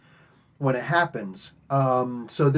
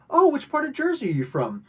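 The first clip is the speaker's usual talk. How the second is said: chirpy quote; 11 semitones higher than usual. Retold or imitated. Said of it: "And she's like".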